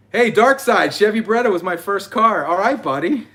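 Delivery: loud, high, cheery shout-out; excited greeting. Real, not acted. Host greeting a livestream viewer, loud and excited.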